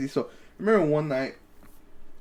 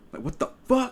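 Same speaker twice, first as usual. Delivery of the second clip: ordinary delivery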